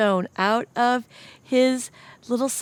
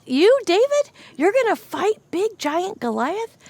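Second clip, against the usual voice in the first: shocked disbelief; 10 semitones higher, 3 dB louder than usual. Acted. Crowd doubting David.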